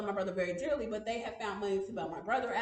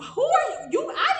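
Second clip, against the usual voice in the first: much higher, louder laughing shout; angry shout; 12 semitones higher, 8 dB louder than usual. Retold or imitated. Daughter retells yelling at her parents, laughing angry shout. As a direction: yell it in disbelief. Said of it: "became extremely pissed off".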